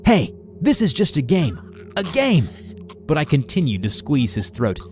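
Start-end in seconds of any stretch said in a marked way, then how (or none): 0.1-2.5 urgent plea. Retold or imitated. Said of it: "he shouted urgently".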